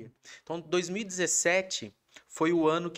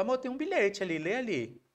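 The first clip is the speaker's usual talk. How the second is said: higher sweet wife voice; sing-song it up high; lightly mimicking wife; 7 semitones higher than usual. Husband voicing his wife pointing to the note. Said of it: "Aí ela falou".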